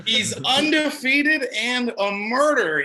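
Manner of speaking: slow, stretched, rising excited boast; hammed-up hype. Mom warning brother about the wrestler, hyped. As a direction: draw out each punch word, pitch climbing.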